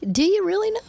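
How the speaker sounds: a sky-high rhetorical challenge; challenging question. Real, not acted. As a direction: throw the question up high at the crowd. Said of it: "rhetorical challenge to audience".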